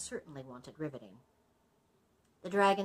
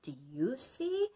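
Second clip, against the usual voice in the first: hammed-up panto host asking where the bad guy is; pantomime character; 12 semitones higher, 6 dB louder than usual. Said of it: "will say".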